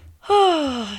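big, loud exhale; heavy sigh. Real, not acted. Storyteller facing complicated plans, letting out a heavy sigh. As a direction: let out a long, loud sigh.

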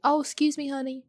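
high-pitched Southern politeness; country accent. Retold or imitated. Friend mocked for her accent, high polite Southern voice. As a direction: lift it high with a country lilt. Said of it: "makes fun of me sometimes for my accent".

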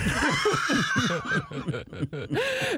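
shrieking laugh; high burst of laughter. Real, not acted. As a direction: lose it completely. Cracks up at punchline.

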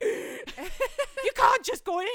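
high, loud, drawn-out squawk of disbelief; hammed-up scandalised outburst; shocked protest. Guest sees him walk into the mansion, shocked.

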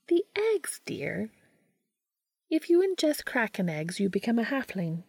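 high witch voice, mildly annoyed; a haughty witch setting them straight; Rowena, mildly annoyed. A narrator's reading. Rowena's voice in story.

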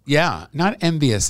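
slow, high and loud on not envious; firm correction. Real, not acted. Rejecting the guest host's word with emphasis. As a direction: push back hard on the word envious.

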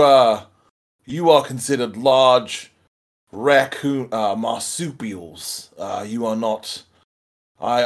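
hesitant Aussie voice, backpedalling; nervous Australian accent. Acted. Pete, nervously placating the panda, in an Australian accent. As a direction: talk your way out of trouble, Aussie style. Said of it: "From Australia".

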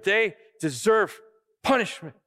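pleading that they deserve punishment; self-righteous pleading. Acted.

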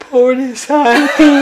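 high, drawn-out mock sympathy; mock pity. Husband mimicking his oversensitive wife, mock pity. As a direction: squeak it out slowly with a laugh close behind. Mimics wife's pitying reaction.

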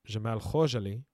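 "Jamal Khashoggi" with a throaty Arabic kh and a zh sound; Arabic "Jamal Khashoggi", not anglicized; full Arabic pronunciation. Real, not acted. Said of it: "I have to say it with my, uh, full Arabic".

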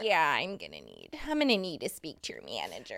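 entitled customer asking for manager; Karen voice. Pretend.

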